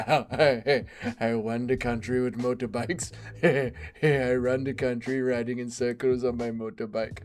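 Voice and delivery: low, soft mocking warlord voice through laughs. Mocking a fighter as an African warlord, low and soft. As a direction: mimic a warlord bragging, laughing. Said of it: "being an African warlord".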